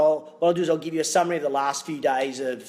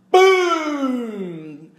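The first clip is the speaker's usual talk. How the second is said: loud, high, triumphant boom; 8 semitones higher, 6 dB louder than usual. Real, not acted. Ending the smoothie bar story, loud triumphant boom. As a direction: slam the punchline home.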